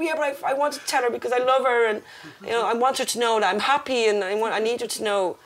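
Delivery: high, insistent self-justification; retold pleading. Crying daughter explaining herself to dad, retold pleading. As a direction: plead your case, slightly hammed up.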